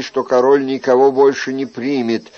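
ordinary delivery

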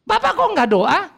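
put-on high, slow, puzzled child voice; a kid asking his dad why; child's question. Child asks father why he skips prayer, high puzzled voice.

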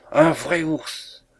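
high, drawn-out cry of alarm; alarmed exclamation. A narrator's reading. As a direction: cry it out slowly, aghast, as if fleeing. Usherette flees, discovering a bear.

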